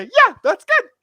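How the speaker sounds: loud squeaky falsetto way up; high-pitched Jerry. Retold or imitated. Podcaster showing his high-pitched owner impression, loud squeaky falsetto. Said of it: "I just wanted to do the high pitch".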